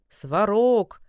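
loud call to the heavens. Acted. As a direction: shout the god's name. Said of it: "не унимался Калидар".

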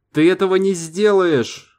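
very high, frantic shout; panicked yell. Acted. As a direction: threaten him in a shrill panic. Сейга keeps yelling, gun drawn.

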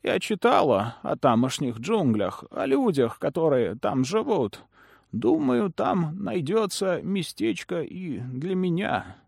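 put-on high, slow, honeyed old woman; sweet, sinister smiling crone; Ламия. A narrator's reading. Lamia speaking, same voice. (приторный голос старой ведьмы)

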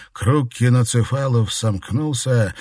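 ordinary delivery, nothing marked

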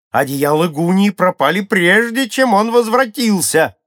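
high, loud acted shouting, climbing toward the end; crowd outcry. A narrator's reading. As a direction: cry it out as an indignant crowd. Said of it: "закричали все сразу". (громкий возмущённый крик толпы)

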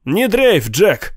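mild, high-pitched yell; calling out. A narrator's reading. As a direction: call out from the crowd to buck him up. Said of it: "Кто-то крикнул".

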